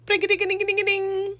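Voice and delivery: high sing-song ringtone imitation; phone ringtone. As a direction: chirp it out like a ringing phone. Imitating her phone ringing.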